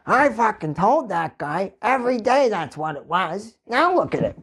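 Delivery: high-pitched cartoonish older man ranting; hammed-up squawking old safety boss; safety guy voice. Worker mimicking the safety guy's rant, high cartoon voice. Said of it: "I do that voice at work".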